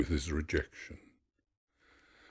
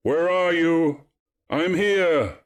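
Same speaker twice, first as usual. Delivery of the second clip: high, loud call into the distance; hallooing; 11 semitones higher, 6 dB louder than usual. A narrator's reading. Narcissus calls out to his strayed companions, hallooing. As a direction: halloo for lost companions, long falling ends. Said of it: "He hallooed them".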